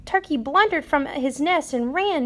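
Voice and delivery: plain reading voice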